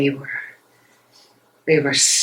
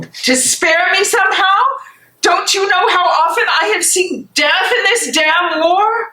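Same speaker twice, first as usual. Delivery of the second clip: much higher, hot indignant cry; distraught woman raging at her protector; angry outburst; 10 semitones higher than usual. Acted. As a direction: hurl the question back at him. Furious at being shielded.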